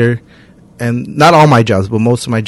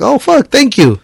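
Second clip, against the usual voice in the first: "Oh, fuck, thank you." jumps up high in pitch; surprised thanks; 10 semitones higher, slower than usual. Retold or imitated. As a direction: caught off guard by a tip. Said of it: "get all excited for a dollar".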